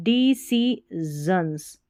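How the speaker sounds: decisions drawn out syllable by syllable; very slow reading. Shown as a demonstration. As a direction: stretch decisions out slowly for learners. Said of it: "reading the passage slowly".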